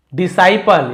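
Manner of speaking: Disciple called out high and loud as a model; teacher's loud model of disciple; loud model word. Shown as a demonstration. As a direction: announce disciple clearly, loud with a falling tune. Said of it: "ये देखिए disciple".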